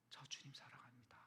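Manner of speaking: a secret vow, whispered; whispered confession. Retold or imitated. Pastor confessing his love to Jesus, in a whisper. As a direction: breathe it, barely a whisper.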